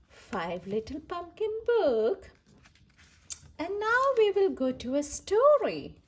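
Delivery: ordinary delivery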